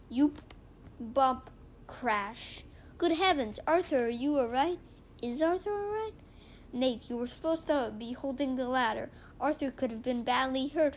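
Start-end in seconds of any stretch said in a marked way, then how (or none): 3.0-6.1 higher, louder fretful cry; alarmed teacher. Acted. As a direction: gasp in mock alarm for Arthur. Teacher panics over Arthur.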